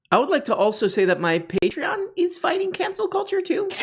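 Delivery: high, put-on announcer voice; mock pitch. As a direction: sell the Patreon in a silly high voice. Mock promo of his Patreon.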